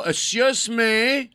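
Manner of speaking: high, slow, offended "Excuse me?"; indignant hotel guard demanding respect; drawn-out indignant excuse me. Retold or imitated. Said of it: "I go".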